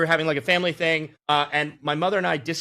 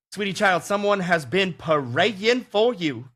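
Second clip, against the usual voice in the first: slow, higher Southern grandma lilt, lightly imitated; Georgia grandmother voice; 5 semitones higher, slower than usual. Narrator retelling the Delta agent's good news in a lightly imitated Georgia grandmother voice. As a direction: drawl it out like a sweet Georgia grandmother.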